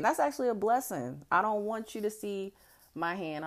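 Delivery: ordinary talk, nothing marked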